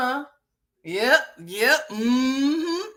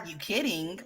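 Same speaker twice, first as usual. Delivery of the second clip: mock-deadpan echo, low and soft; her quiet reply; 7 semitones lower, 10 dB quieter than usual. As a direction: lower and much softer. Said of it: "She's like".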